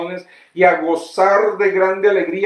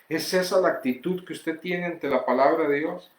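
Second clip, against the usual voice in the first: ordinary delivery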